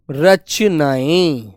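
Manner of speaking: very slow, syllables stretched; drawn-out model word. Shown as a demonstration. Teacher modelling the plural for pupils, drawn out slowly. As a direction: stretch out each syllable for the class.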